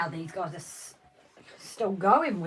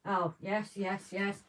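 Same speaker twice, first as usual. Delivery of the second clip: ordinary delivery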